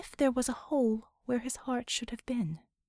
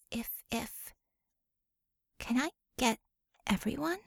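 soft whispered scheming to herself; whispered thoughts; 4 dB quieter than usual. Acted. Bella whispering her private ambitions to herself. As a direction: hush it, plotting privately.

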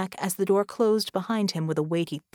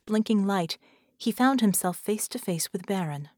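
ordinary delivery

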